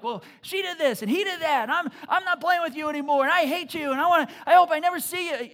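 put-on loud, high whiny kid squabble; bickering kids. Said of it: "they're, like, bickering with each other".

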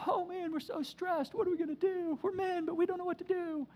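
high, whiny put-on panic; mock whiny soldiers. Preacher mocking fearful soldiers, high and whiny. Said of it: "He's not like".

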